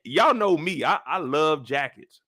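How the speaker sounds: ordinary delivery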